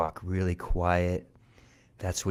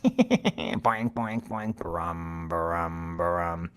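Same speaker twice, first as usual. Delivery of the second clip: giggle and drumming; 3 semitones lower, much slower than usual. A narrator's reading. Mouse happily plays Cat's drum, giggle and drum noises. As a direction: snicker into bouncy boings, then humming brums.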